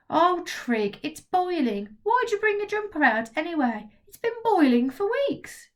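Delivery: put-on girl gently scolding her friend about the heat; exasperated girl Maggie. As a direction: tease him like an exasperated friend. Maggie chides Trig.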